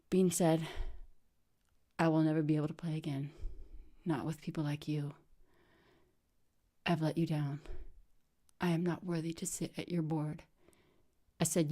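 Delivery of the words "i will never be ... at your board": defeated. Retold or imitated. Bean defeated, quiet.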